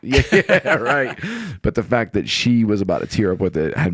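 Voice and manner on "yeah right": laughing. Real, not acted. Laughs while agreeing.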